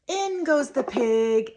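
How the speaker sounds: put-on high, slow, loud toddler sing-song; gasp, then sing the words out slowly; delighted, stretched-out lilt. Shown as a demonstration. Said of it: "and I'm sing-songing".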